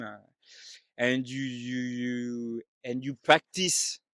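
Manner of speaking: put-on halting, heavily French-accented English. Telling what my son said to Papa, in a put-on French accent. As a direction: put on a French accent, drawn out with many uhs. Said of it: "exactly what I did now".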